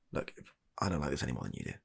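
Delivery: ordinary delivery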